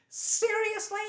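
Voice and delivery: very high, mocking disbelief; outraged at a double standard; incredulous. Real, not acted. Incredulous outrage at ban policy.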